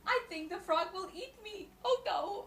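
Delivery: frightened bug. Acted. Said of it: "the bug said".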